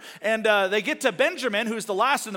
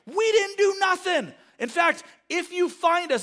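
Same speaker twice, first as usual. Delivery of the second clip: indignant denial; 10 semitones higher, 4 dB louder than usual. Retold or imitated. Accused brothers protest to the servant, high-pitched indignant denial. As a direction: squeal it out, wronged and desperate.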